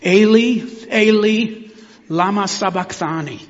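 Jesus crying out to God from the cross; crying out loud. A narrator's reading. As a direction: cry the Aramaic out like a man in agony. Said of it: "cried out in a loud voice".

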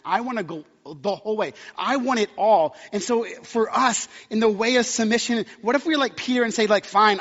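loud preaching at full force; fervent, loud plea for cleansing. Acted. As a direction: cry it out to the whole church. Peak of the sermon, Peter's plea.

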